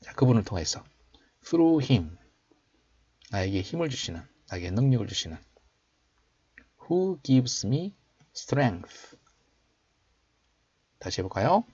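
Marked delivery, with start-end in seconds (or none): 1.4-2.2 slow, raised teaching voice; slow model reading. Shown as a demonstration. Teacher showing learners the English verse, slow model reading. 6.8-9.2 slow, raised teaching voice; slow model reading. Shown as a demonstration. Teacher showing learners the English verse, slow model reading.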